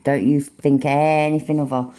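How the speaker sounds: ordinary talk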